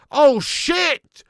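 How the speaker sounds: loud, high cry of surprise; shocked outburst. Real, not acted. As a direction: burst out in amused shock. Reacting to the viewer's message.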